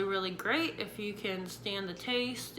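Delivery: usual speaking voice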